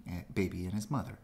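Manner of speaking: usual speaking voice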